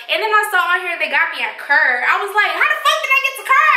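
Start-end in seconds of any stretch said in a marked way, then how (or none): 2.6-3.8 fast, very high-pitched disbelief; acting out a bewildered freak-out, pitch way up; retold freak-out. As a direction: squeal the question in mock panic. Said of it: "I'm freaking the fuck out".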